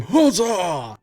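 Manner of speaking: high, loud drawn-out cheer; cheering crowd. Acted. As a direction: cry out like rallied dwarves. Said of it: "You hear a number of them".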